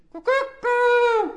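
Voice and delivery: high, loud crowing call; rooster crow. Retold or imitated. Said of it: "They say".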